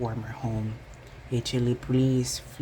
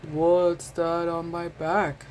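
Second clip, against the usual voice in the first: slow grumbly hedgehog voice, louder; grumbling; 4 semitones higher, 4 dB louder, slower than usual. A narrator's reading. Hedgehog complaining about his back, slow grumble. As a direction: grumble it out, puzzled. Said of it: "a grumbling voice".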